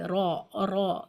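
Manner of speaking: را said low and full, with a heavy aa. Shown as a demonstration. Teacher demonstrating the heavy ra. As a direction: say را heavy and deep. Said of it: "اس کو را پڑھنا چاہیے".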